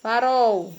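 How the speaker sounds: "Farol" said with a U sound for the L; "Farol" ending on the vowel U; final L as U. Shown as a demonstration. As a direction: pronounce "Farol" as if written Farou. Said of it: "o som dele já é diferente".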